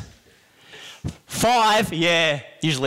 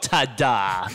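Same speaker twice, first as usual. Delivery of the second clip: sad ta-da; 8 semitones lower than usual. Retold or imitated. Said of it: "the lamest, saddest thing in the world".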